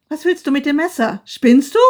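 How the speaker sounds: high, loud panic; panicked scream. A narrator's reading. Wife screams in panic at husband with knife.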